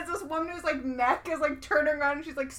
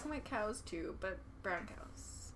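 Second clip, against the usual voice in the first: whispering; 3 semitones lower, 13 dB quieter than usual. Real, not acted. Whispered aside.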